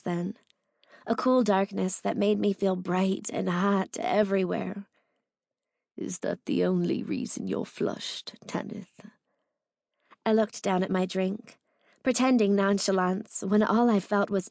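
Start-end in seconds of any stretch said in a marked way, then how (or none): 6.0-9.0 seductive whisper. A narrator's reading. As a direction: whisper it close, teasing.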